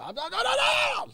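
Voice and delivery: imitated scream climbing very high; George Costanza's father. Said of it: "he would go up and scream".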